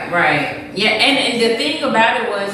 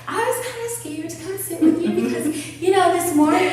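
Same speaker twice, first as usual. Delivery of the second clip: put-on timid new girl; 8 semitones higher, 3 dB quieter than usual.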